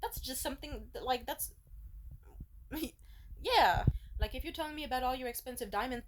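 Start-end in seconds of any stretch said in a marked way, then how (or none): 1.6-3.4 soft unvoiced laugh; a quiet breathy laugh; airy laugh. Real, not acted. Laughing at own point.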